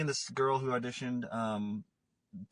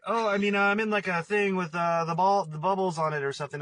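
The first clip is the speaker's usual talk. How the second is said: louder, higher, rushed excuse; nervous backpedaling; 6 semitones higher, 7 dB louder than usual. Retold or imitated. Telling his girlfriend about the role, louder and backpedaling. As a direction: talk your way out of trouble. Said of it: "So I was like".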